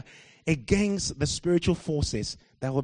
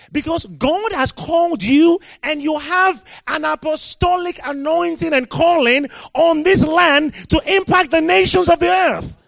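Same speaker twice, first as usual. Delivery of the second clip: preaching at full force; 10 semitones higher, 7 dB louder than usual. Real, not acted. Preacher rousing the British church, loud full-force preaching. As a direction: thunder it at the congregation.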